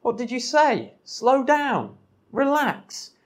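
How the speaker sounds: woman rushed by a stranger, confused and startled. Retold or imitated. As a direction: react like someone caught off guard by a stranger. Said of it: "you'd be like".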